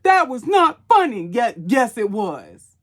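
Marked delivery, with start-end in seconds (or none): 0.0-1.3 loud, high-pitched imitation of outrage; mock offended. Offended people getting mad in her comments, mock outrage. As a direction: mimic an angry commenter, punching each word. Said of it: "overly offended people getting mad in my comments".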